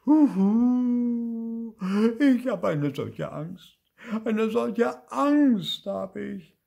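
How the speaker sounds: high, loud wail of fear; hammed-up sobbing little ghost; tearful howling. A narrator's reading. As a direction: bawl it slowly, dragging the words. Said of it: "heulte Bibi".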